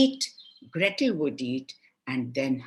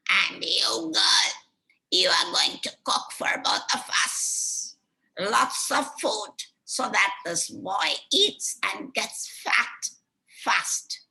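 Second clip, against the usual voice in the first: higher, commanding witch voice; higher witch voice ordering Gretel to cook; bossy witch; 5 semitones higher than usual. Acted. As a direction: order the girl about like a cruel hag.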